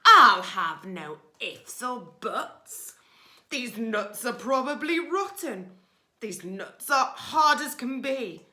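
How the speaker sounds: loud snarling robber rat. Acted. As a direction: snarl it like a greedy bully.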